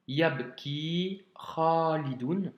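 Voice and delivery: slow Yabki Khalidoun with drawn-out long vowels; model reading. Shown as a demonstration. Teacher correcting the reading exercise, Yabki Khalidoun slow and drawn out. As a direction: stretch the ki and kha in Yabki Khalidoun. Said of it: "nous avons".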